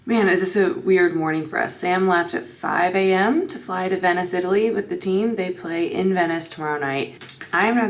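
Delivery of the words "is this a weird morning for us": usual speaking voice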